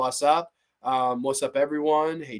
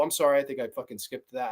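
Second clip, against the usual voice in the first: ordinary talk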